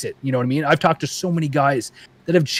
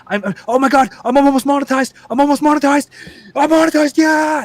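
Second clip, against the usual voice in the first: rising higher and higher with glee; building excitement; 11 semitones higher than usual. Retold or imitated. A new creator watching the monetization count, rising higher and more thrilled. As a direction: climb from eager to thrilled.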